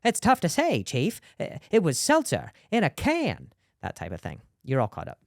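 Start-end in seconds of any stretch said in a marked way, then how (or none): 0.0-3.5 high, hesitant cop voice; detective voice. Pretend. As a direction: stammer like a clueless detective. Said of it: "the chief would be like".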